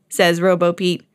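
ordinary delivery, nothing marked

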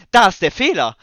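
loud, high eureka cry; excited shout. Real, not acted. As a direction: shout out the discovery. Discovering the bug excitedly.

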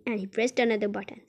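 plain reading voice

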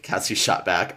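ordinary delivery

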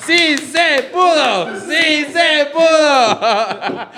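high, drawn-out crowd chant; hammed-up victory chant; mock triumphant cheer. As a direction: celebrate like a stadium crowd, slowly. Mock celebration of right answer.

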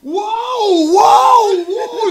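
high, loud wowing; amazed shout. Retold or imitated. Gringos amazed, imitated. (gritando uau, maravilhado)

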